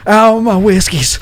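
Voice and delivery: laughing mock lament; comic wail. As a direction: wail over spilled drink. NPC laments spilled drink.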